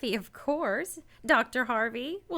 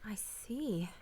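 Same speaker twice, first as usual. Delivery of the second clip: breathless whisper, barely voiced; 12 dB quieter than usual. Laura whispering to Alec as he leaves, barely voiced. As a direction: hush it out, all breath. Lovers' final quiet words.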